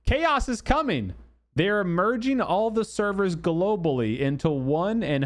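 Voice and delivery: high-pitched, over-dramatic announcement; mock doom proclamation. Streamer reading a troll's post to viewers, mock-dramatic proclamation. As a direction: proclaim it like a grand troll prophecy. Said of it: "in his infinite trolling wisdom says".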